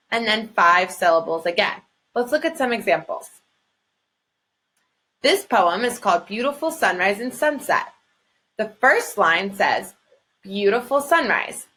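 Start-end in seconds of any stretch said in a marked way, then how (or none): none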